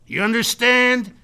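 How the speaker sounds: loud, high, emphatic declaration; forceful lecture to an employee; rousing boss speech. Retold or imitated. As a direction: drive each point home, peaking on the third. Acted motivational speech to manager.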